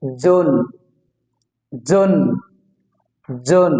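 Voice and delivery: জুন with a firm, held N; pressed final N. Shown as a demonstration. Teacher showing the dental N, জুন with pressed N. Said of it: "দন্তান্ন একটু চাপ দিয়ে বলতে হবে".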